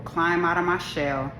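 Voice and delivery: normal talking voice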